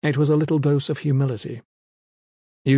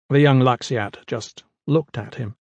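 usual speaking voice

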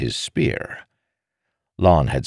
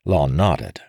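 usual speaking voice

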